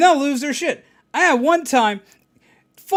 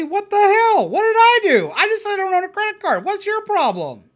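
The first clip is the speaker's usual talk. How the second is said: high, loud mock outrage; indignant disbelief; 10 semitones higher, 3 dB louder than usual. Caller curses him, high indignant whine. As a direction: whine of wounded innocence.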